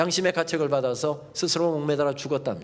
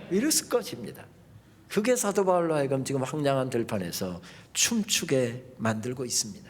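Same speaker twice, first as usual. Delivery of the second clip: ordinary delivery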